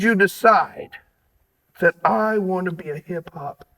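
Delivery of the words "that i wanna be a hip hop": low, soft, measured voicing of someone's thought; soft and intense, much lower; hushed inner resolve. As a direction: drop low and quiet, phrase by phrase. Voices guest's decision inside question.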